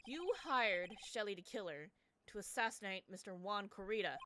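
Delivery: ordinary delivery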